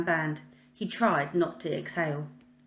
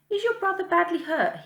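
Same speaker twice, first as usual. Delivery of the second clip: a lightly voiced boy, higher, gently worried; concerned boy; 12 semitones higher, 3 dB louder than usual. Fred asking the girl about her crying brother, concerned.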